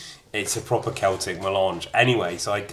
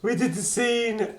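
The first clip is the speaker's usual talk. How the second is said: much higher, emphatic repeat; excited repeat. Real, not acted. Repeating it to viewers with high excitement. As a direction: push it up with amused disbelief.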